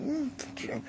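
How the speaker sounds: high, soft muttered insult; muttered curse. Retold or imitated. Jason player answering the cheater's boast, soft high mutter. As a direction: mutter it under your breath.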